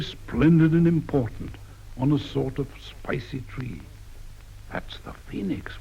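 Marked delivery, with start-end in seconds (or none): 4.7-5.7 soft, breathy secret whisper. Acted. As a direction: whisper it like a secret told in a garden. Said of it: "whispers Prester".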